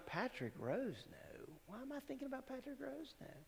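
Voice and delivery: high, soft, quick wondering to oneself; puzzled musing. Acted. Preacher voicing a driver's stray thought, puzzled musing. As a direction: murmur it high, as a thought popping up.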